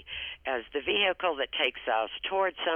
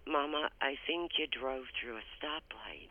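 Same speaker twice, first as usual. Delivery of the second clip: whisper; 9 dB quieter than usual. Retold or imitated. Timidly telling mom she ran light.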